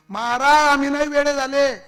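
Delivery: high, loud insistent denial; loud protest. Acted. Alarmed villager insisting to be believed, shouting. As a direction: protest at the top of your voice.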